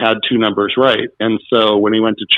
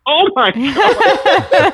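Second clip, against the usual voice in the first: very high, slow, drawn-out gasp of disbelief; stunned surprise; much slower than usual. Retold or imitated. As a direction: stretch it out like you can't believe it. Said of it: "Really surprised that he won".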